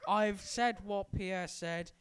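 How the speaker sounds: slow, loud, deliberate introduction; slow demonstration of own voice for listeners; demonstrating own accent. Shown as a demonstration. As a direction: spell it out slowly for comparison. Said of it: "just check the similarities if you want".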